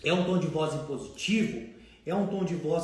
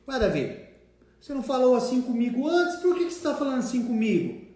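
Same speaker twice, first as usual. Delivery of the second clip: higher, louder mock indignant protest; offended debtor; 6 semitones higher, 5 dB louder than usual. Debtor protesting to the collector about the new firm tone, higher and louder mock indignation. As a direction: act hurt that the tone changed.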